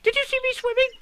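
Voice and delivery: very high, loud little squeak; tiny excited creature voice. A narrator's reading. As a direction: squeal it out, bursting to be noticed. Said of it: "squeaked Roo excitedly".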